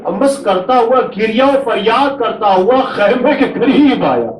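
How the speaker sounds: keen the tragedy aloud to draw the mourners to tears; masaib lament. Acted. Preacher to mourners at a majlis, high wailing lament.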